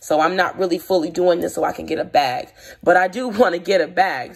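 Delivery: ordinary delivery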